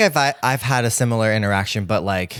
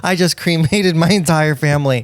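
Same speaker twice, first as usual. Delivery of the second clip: raised, higher mock bar yell; acted holler, louder and pitched up; yelling over noise; 6 semitones higher, 5 dB louder than usual. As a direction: pretend to shout news across a loud bar. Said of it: "like, yelling across it".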